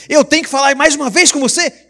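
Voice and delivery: high, loud scolding father voice; stern father. Retold or imitated. Father scolding a stubborn son, loud and high. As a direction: scold your son like you mean it. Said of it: "o pai fala de forma enérgica".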